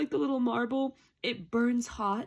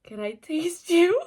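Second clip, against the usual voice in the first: high, slow, rising question; incredulous squeal; 4 semitones higher, 5 dB louder than usual. Acted. Reader repeating hero's request in disbelief, incredulous squeal. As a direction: stretch it upward into a scandalised squeak. Repeats romantic line two ways.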